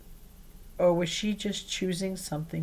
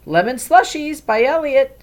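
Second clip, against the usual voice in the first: cheerful offer; 8 semitones higher, 10 dB louder than usual. A narrator's reading. Nori presenting slushie.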